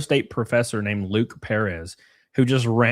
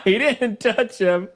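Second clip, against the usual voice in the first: high mocking voice; 12 semitones higher, slower than usual. Mocks the assailant in a high voice.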